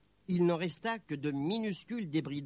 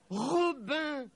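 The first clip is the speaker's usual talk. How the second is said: high, urgent call of the name; astonished high-pitched cry; excited exclamation; 10 semitones higher than usual. A narrator's reading. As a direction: cry out to your sweetheart in amazement. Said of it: "s'écria Nelly".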